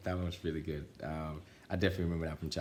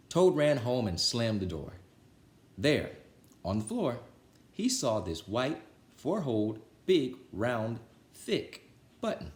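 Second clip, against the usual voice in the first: put-on lively, louder read-aloud voice for children; storybook reading; 4 semitones higher, 5 dB louder than usual. A narrator's reading. Reader telling Frog and Toad to children, lively storybook voice. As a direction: read it like bedtime story time for kids.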